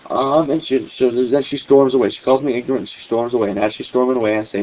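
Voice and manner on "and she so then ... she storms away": ordinary talk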